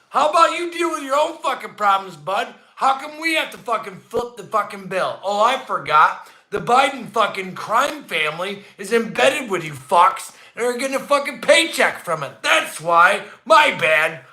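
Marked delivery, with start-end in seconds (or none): none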